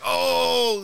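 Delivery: loud, high, stretched-out "oh"; mock the moment it dawns on him; exaggerated high cry of amazement; imitated amazed reaction. Predicts the other's changed reaction.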